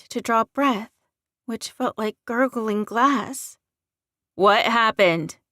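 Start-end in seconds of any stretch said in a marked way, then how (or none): 4.3-5.4 louder, slow, forceful question; mild scream. A narrator's reading. Man sees his wounded alpha carried in, shouting. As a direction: shout it in alarm, not at full scream. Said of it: "Keith screamed".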